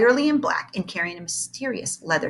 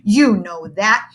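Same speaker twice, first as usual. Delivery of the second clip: sneering; 3 semitones higher, 7 dB louder than usual. Acted.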